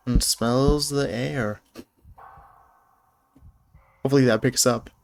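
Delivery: ordinary delivery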